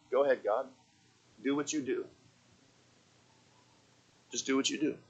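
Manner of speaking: hushed, low, resigned to God; unworried. Acted. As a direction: drop right down, soft and calm. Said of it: "He's not worried about it".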